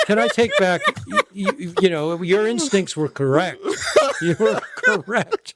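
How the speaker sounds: giggling retraction; host laughing at the reveal. Real, not acted. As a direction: laugh through the words. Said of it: "laughing while speaking".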